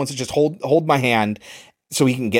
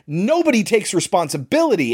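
ordinary talk

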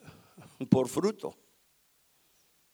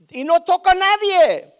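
much higher and louder shout; preaching shout; 12 semitones higher, 12 dB louder than usual. Acted. Preacher to congregation, climactic shout. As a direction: cry it out at the peak.

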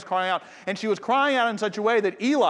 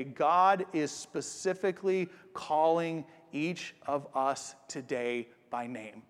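quiet earnest preaching; 4 semitones lower, 3 dB quieter than usual. Acted. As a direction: lean in and let each phrase land softly. Quiet closing proposal of sermon.

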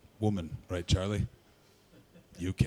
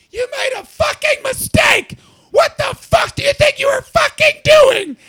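very high, slow, squealed shouting; cartoon-mouse rage yell; 14 dB louder, slower than usual. Raging on the phone at whoever was responsible, cartoon-mouse yell. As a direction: scream it like an angry cartoon mouse. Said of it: "like I was a cartoon mouse angry".

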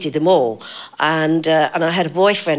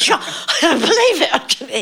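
slow, high, laughing through the words; can't stop laughing while doubting it; laughing disbelief; 11 semitones higher, 3 dB louder, slower than usual. Retold or imitated. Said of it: "he was the one who said".

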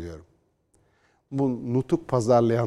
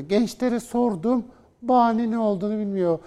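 mock critic; 8 semitones higher than usual. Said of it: "falan diyene".

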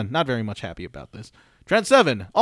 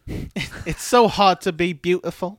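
slow, raised, preening voice; vain pretty boy; 6 semitones higher, 3 dB louder, slower than usual. Retold or imitated. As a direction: drawl it like a vain wrestler. Mocking wrestler's words.

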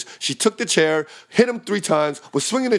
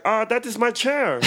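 higher, slow put-on voice for the burger guy; mock Taku voice; 7 semitones higher, slower than usual. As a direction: imitate the offended cook, drawn out. Imitating Taku's accent in story.

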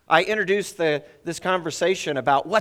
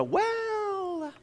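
much higher, a drawn-out stalling well; hesitant students; 3 dB quieter than usual. Retold or imitated. Students stalling when asked about needs, a drawn-out high well. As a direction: stretch the word like stumped students.

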